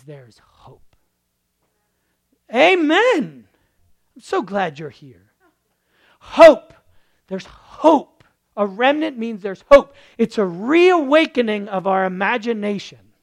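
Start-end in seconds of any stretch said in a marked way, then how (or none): 2.5-3.5 high, loud Amen; rousing amen; shouted amen. Real, not acted. Preacher calls amen. 6.2-13.1 high, loud impassioned preaching; full-force preacher. Real, not acted.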